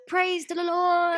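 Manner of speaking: high, slow hallelujah cry; hammed-up churchy praise; mock praise. Hoping the new car arrives, a hammed-up praise. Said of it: "I'm just like".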